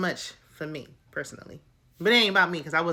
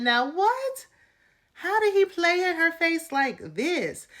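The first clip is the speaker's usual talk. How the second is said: very high-pitched, stretched disbelief; scandalized high exclamation; incredulous outrage; 10 semitones higher, slower than usual. Retold or imitated. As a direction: squeal the question as if you cannot believe it. Outraged reaction to his gaslighting.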